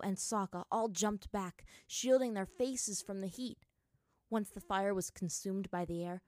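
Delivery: ordinary delivery, nothing marked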